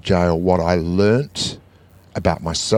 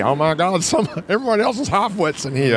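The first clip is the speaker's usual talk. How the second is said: high, amused inmate voice; a hint of a laughing cellmate; lightly voiced inmate; 9 semitones higher than usual. Voicing fellow inmate.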